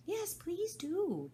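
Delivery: high, slow pleading; a girl begging for a story; pleading Alice. Acted. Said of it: "pleaded Alice".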